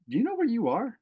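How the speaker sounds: imitating a baffled stranger, high-pitched; retold incredulous question; puzzled questioner. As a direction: ask in high-pitched disbelief. Lady questions him at convention.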